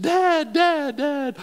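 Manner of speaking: high, loud panicked calls to Dad; a frantic son, then a dad brushing it off; calling out. Retold or imitated. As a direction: shout for Dad then the sputtering reply.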